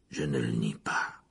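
low, slow, calm admission. Acted. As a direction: admit it without flinching. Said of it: "dit-il très calme".

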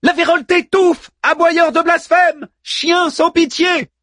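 high, theatrical shouted curse; crying out. A narrator's reading. Manmut recites Tempest insults over the radio, high shout. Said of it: "et s'écria".

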